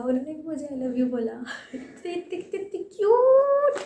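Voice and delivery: high, loud squealing gush through laughter; excited squeal. Real, not acted. Fan gushing that the star said it back, squealing. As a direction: squeal with fangirl delight. Gushing over the star's reply.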